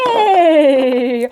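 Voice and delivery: loud whinnying neigh; loud horse neigh. Pretend. Teacher voices horse neigh.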